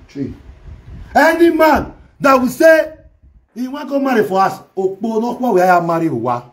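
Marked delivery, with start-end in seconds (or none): 1.1-4.2 shout. Real, not acted. Loud scolding of Ebony.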